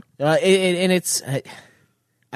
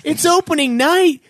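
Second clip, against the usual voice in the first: ordinary talk, nothing marked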